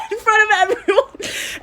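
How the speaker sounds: very high, squeaky laugh-talk; real helpless laughing. Real, not acted. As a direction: barely get it out through laughter. Cracking up at own story.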